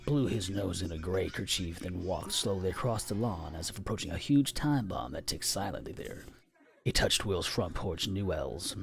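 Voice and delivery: ordinary delivery, nothing marked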